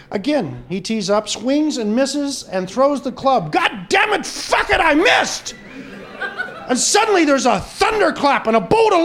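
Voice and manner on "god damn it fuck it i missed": angry curse. Acted. Golfer curses after third miss.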